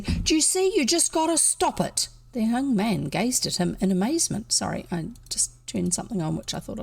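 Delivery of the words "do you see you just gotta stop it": very high, insistent demand; fierce. A narrator's reading. Boy pressing the man in the hole, fierce. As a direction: drive the order home, high and sharp. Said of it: "he said fiercely".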